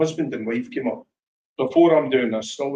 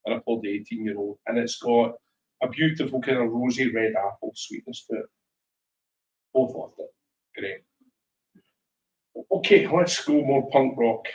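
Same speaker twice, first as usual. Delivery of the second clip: usual speaking voice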